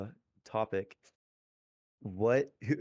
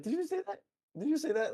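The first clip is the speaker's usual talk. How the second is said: high, excited disbelief; freaking out. Retold or imitated. Girlfriend on the phone, freaking out in high disbelief. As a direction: squeak it out, can't believe he said it. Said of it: "she was, like, freaking out".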